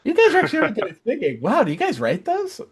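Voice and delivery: mock fan voice. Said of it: "a lot of comments about".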